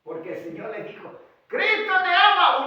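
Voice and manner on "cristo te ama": high, loud acted cry; shouted proclamation. Preacher repeating the pastor's cry, loud shout. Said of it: "Gritó el pastor".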